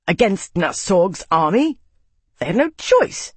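sharp, bitter retort; spat angrily. Acted. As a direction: spit the words out in anger. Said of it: "Max spat".

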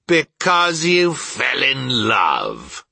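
mock it back at half speed; scornful growl. A narrator's reading. Scrooge sneering at his nephew's marriage, growling slowly. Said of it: "growled Scrooge".

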